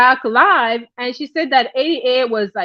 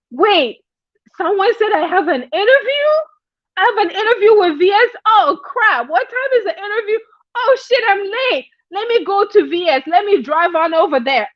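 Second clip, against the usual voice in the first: flustered panic; 7 semitones higher than usual. Retold or imitated. Eighty-eight panicking, late.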